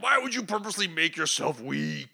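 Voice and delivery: loud, high, hammed-up indignation; acted outburst of disgusted disbelief; mock outraged scolding. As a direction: berate him like an appalled comic-book villain. Acted villain scolding a weakling.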